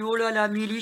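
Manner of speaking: a loud, high, delighted "oh là là" to herself; retelling her thrilled reaction to the price, loud and high; excited self-talk. Retold or imitated. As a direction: cheer yourself on over a bargain. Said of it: "j'ai dit".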